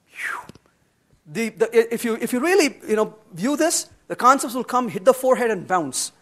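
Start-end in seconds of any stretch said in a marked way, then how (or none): 0.1-0.4 an unvoiced sigh of relief; whispered phew. Older programmer giving up on new ideas, a whispered phew. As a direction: breathe out a phew, as if glad to be done. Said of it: "you're like".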